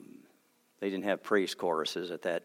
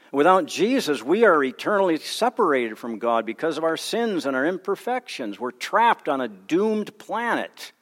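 higher, louder pulpit proclamation; fervent preaching; 7 semitones higher, 6 dB louder than usual. Acted. Pastor proclaiming the Savior to the congregation, fervent full preaching.

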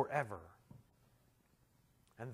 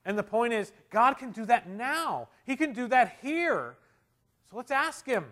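high, loud, ringing exhortation; full-force preaching; 9 semitones higher, 12 dB louder than usual. Real, not acted. Preacher urging the church to ask God now, loud and high. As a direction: drive it home from the pulpit.

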